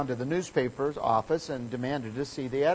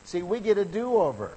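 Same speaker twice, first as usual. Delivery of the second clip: preaching climax; 7 semitones higher than usual. Real, not acted. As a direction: lift the church with good news. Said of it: "preaching climax".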